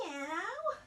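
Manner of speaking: teacher meowing during cat sit-ups. Pretend. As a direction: mew like a little cat. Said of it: "our cat sit-ups".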